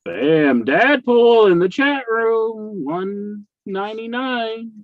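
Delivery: loud, high, slow hype call; excited announcement. Real, not acted. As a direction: call out the donation slowly, hyped up. Announcing a chat donation.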